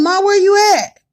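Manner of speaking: loud high call across the house; calling out to mom. Retold or imitated. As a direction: shout for mom from another room. Calling out to her mother again.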